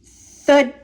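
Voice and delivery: ordinary delivery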